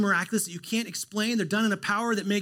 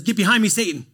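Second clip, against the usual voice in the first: loud, firm rebuke; stern rebuke; 3 semitones higher, 10 dB louder than usual. Retold or imitated. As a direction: push him away with the words. Jesus rebukes Peter.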